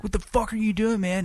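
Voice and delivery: high mock yell repeated; yelling it a second time; high retold yell; angry yell. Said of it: "I was like".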